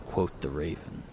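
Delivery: plain reading voice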